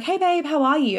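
bright, high hello to a friend; friend's cheery greeting. Retold or imitated. Friend Amy answering the phone, cheery high greeting. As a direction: chirp it like a caring friend. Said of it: "she was like".

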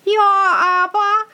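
put-on high, fast child's voice; child blurting out the truth, high and quick; little child. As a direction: blurt it out like an innocent small child. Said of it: "sagte ein kleines Kind".

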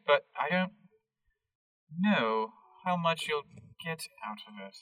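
put-on light British accent, hesitant and evasive; British Harold. Harold the greenhouse keeper dodging the group's questions, British accent. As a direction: hedge in a mild British accent. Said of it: "Harold is, all caps, British".

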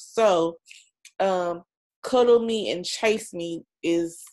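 usual speaking voice

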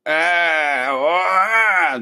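high, rising to a shout, "Ah, ah"; Biden grunt. Retold or imitated. Host showing Biden's general tone, grunting then shouting. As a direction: grunt it out, building to a yell. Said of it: "That's the general tone".

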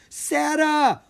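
high, loud cry of her dragon's name; alarmed yell. A narrator's reading. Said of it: "Petra yelled".

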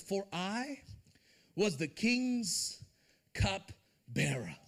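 drawn out word by word, "cupbearer" dropping low; slow emphatic reading. Shown as a demonstration. Preacher stressing a Bible detail, slow and weighty. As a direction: linger on "was the king's cupbearer" very slowly. Reading the verse's last words.

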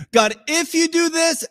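high-pitched conditional, held up; bargaining if. Acted. Believer bargaining with God, mock hopeful high pitch. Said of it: "And if then".

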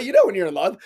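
ordinary delivery

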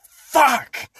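retold shout. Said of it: "the first thing I said was".

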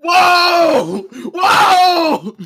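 high shouted whoa; shouting whoa. Real, not acted. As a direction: yell it in disbelief. Reaction to shock pick.

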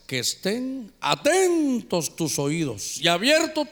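very slow, each word weighed; slow emphatic reading. Shown as a demonstration. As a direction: read it slowly to stress it. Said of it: "¿Qué cosa esta?". (lectura lenta y enfática)

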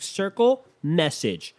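hammed-up catty outburst; mock reality-show bickering. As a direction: play an offended contestant. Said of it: "They're talking into a computer like".

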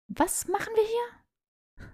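high, slow, soft puzzled voice; a lightly voiced confused girl; puzzled Patil. A narrator's reading. Patil arriving confused, asks the group, high and slow.

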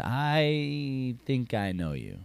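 slow, far-off drawl on the woman's line; lingering, slowly suspicious; drawn-out recognition. Acted. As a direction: stretch it out, trying to place him. Voices the woman at the bar.

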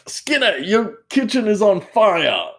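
high, loud, slow indignant boss; hammed-up alarmed superintendent; Chalmers impression. Superintendent yelling at Skinner about the fire. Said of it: "And he's like".

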